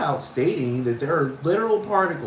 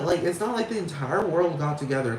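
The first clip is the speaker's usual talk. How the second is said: normal talking voice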